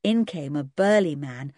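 usual speaking voice

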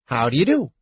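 light, courteous greeting; polite. A narrator's reading. Kender offers his hand to the knight, politely. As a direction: politely introduce yourself, slightly lifted pitch. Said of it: "the Kender said politely".

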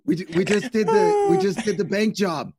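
a light New York crook begging to get in; New York mobster. Pretend. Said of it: "accent from New York City".

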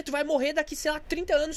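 ordinary delivery

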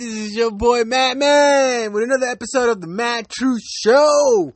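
high-pitched, loud hype-man greeting; hyped show intro. Real, not acted. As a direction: shout out the show opening with big, drawn-out energy. Podcast host shouts his show intro.

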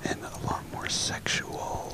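hushed sultry whisper; ASMR whisper. Pretend. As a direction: breathe the words softly. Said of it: "a little A S M R".

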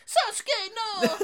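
high, loud hammed-up scream; mock horror scream. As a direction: shriek like a horror victim.

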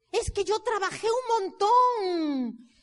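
imitated self-pitying excuse; mock complaint. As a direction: parody someone bragging of hard work. Mocking people who claim effort.

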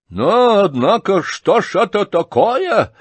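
high, loud, menacing cry; angry exclamation. Acted. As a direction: exclaim it in sudden anger. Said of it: "грозно и внезапно воскликнул рассердившийся".

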